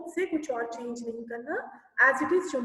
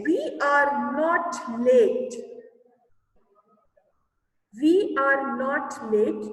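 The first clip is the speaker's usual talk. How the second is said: very slow, louder, word by word; slow dictation; 5 dB louder, much slower than usual. Shown as a demonstration. Teacher reading an exercise sentence to pupils, very slowly. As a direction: drag each word out for dictation.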